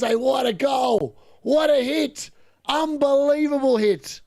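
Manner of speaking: high, slow excited shout; long goal yell. Real, not acted. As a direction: yell it out in delight. Still celebrating the goal.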